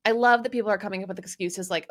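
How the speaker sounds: ordinary delivery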